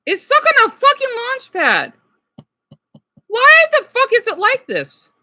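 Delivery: high, loud cursing frustration; fed-up outburst. Real, not acted. Streamer cursing his frozen MacBook, angry. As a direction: vent at the machine. Laptop still stuck.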